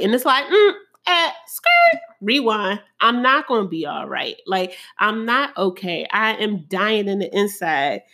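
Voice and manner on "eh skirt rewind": squeaky high "skirt" like a record scratch, then a flat "rewind". Pretend. Rejecting friends' advice with a squeaky record-scratch "skirt". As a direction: stop the tape with a mock scratch.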